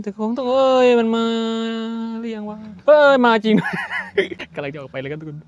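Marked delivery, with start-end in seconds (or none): 0.0-3.5 higher and louder, laughing in alarm; startled outburst. Real, not acted. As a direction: break off the song in fright. Ghost appears mid-song.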